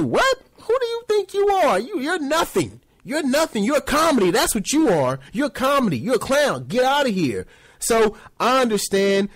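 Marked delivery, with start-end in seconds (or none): 0.0-7.5 high, sneering dismissal of a clown; scornful team voice. Retold or imitated. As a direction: scoff him out the door. Mock team scolding the player.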